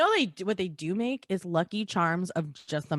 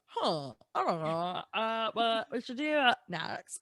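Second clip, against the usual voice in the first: slow clueless executive; 2 semitones higher, 4 dB quieter, slower than usual. Retold or imitated. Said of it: "Kellogg's is, like, sitting in his office".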